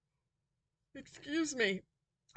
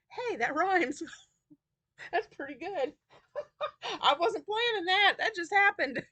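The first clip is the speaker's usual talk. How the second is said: laughing; 8 semitones higher than usual. Real, not acted. Laughs at her accidental rhyme.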